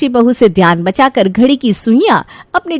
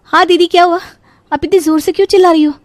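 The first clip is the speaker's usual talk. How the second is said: high, hurried reply; rushed, raised-pitch questioning; flustered daughter-in-law; 9 semitones higher, faster than usual. Acted. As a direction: answer quickly as if just summoned.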